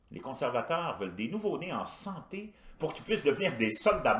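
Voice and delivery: animated, indignant comic monologue; stand-up rant. Acted. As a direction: rant with sarcastic disbelief, swinging from high outbursts to dry asides. Performs his version of a stand-up routine.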